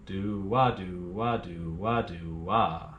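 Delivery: gently tip each wah; light offbeat accent. Shown as a demonstration. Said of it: "a little accent on it".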